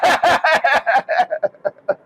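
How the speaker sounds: cracks up at his own comeback; real hearty laugh. Real, not acted. Retelling the phone call, laughing hard.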